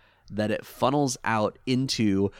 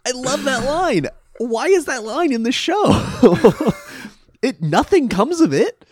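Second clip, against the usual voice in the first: high, loud, laughing through words; giggling while talking; 13 semitones higher, 6 dB louder than usual. Real, not acted. Laughing about a favourite line.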